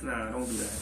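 usual speaking voice

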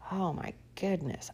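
ordinary talk, nothing marked

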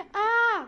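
high, loud shriek; sudden scream of fright; terrified scream. A narrator's reading. Isabel looking under the cinema seats, screaming. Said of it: "gilde Isabel".

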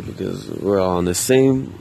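ordinary talk

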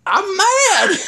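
high, indignant mock outburst; retold anger. Man defending his outburst to his partner, high-pitched retold anger. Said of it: "I was so mad".

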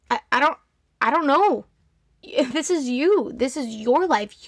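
ordinary delivery

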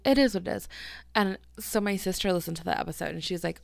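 ordinary talk

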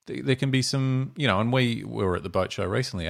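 ordinary delivery

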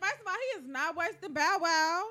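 loud protest. Real, not acted. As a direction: defend him at full voice. Defends Romeo, shouting.

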